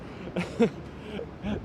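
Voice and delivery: soft, high-pitched laughing; amused laugh. Real, not acted. Streamer in a wind tunnel street, amused laugh. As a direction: laugh it off, softly.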